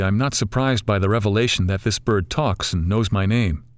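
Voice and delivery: usual speaking voice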